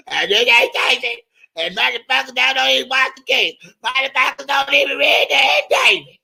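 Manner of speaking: high, screechy mocking old woman; mock the rival lady's screechy voice. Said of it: "that old screechy, scratchy ass voice".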